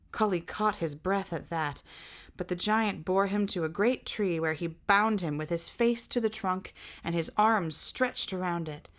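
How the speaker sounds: ordinary delivery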